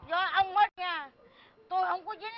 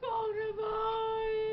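sobbing wail; 3 semitones higher, 5 dB quieter, slower than usual. Acted. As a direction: drag it out through sobs. Mother wailing over ruined daughter.

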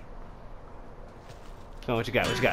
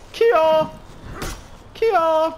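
repeated battle cry; much slower than usual. Pretend. Player striking a game enemy, high battle cries.

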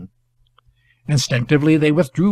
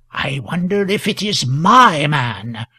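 plain reading voice

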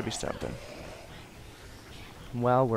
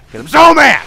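sudden high, loud yell; mad outburst; 13 semitones higher, 15 dB louder than usual. Pretend. Player throwing a plant at Mindy, mad shout. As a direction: explode on "so mad". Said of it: "Because I'm so mad".

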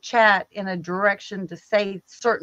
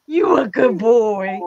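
slow, louder put-on voice for praising a dog; good-boy pet voice; 4 semitones higher, 6 dB louder, slower than usual. Showing how she talks to her husband, slow dog-praising voice. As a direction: praise him like a puppy. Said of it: "I do that to my husband all the time".

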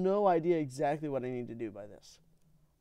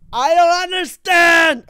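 high, drawn-out yell; very loud, high wail of confusion; frustrated shout; 12 semitones higher, 15 dB louder, slower than usual. Real, not acted. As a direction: bellow it slowly in helpless frustration. Frustrated outburst at the game.

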